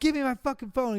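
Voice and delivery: imitated heated confrontation; retold angry demand. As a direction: act out demanding stolen property back. Acts confronting the thief.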